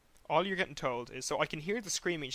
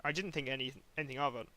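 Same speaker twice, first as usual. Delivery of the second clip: normal talking voice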